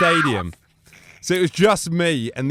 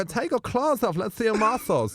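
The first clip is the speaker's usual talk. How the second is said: Bruno voice; 7 semitones higher than usual. Retold or imitated. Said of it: "I go".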